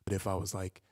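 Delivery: ordinary talk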